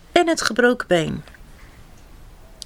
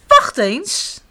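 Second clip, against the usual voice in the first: high, sudden realization; storybook mouse exclaiming; calling out; 6 semitones higher, 4 dB louder than usual. A narrator's reading. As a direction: call out as the idea strikes. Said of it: "riep muis".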